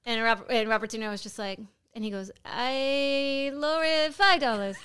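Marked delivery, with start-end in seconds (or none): 2.4-4.8 mock landlord. Quoting the landlord.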